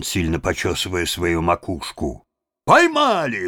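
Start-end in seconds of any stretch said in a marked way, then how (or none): 2.7-3.5 high, louder shout of success; excited shout; triumphant cry. A narrator's reading. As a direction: call out the catch proudly. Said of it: "вскрикнули вошедшие".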